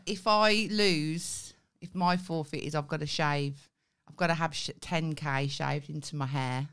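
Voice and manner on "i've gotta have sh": usual speaking voice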